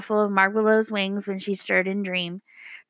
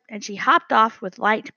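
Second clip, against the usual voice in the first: ordinary delivery, nothing marked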